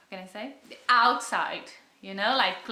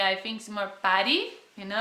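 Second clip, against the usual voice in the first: ordinary delivery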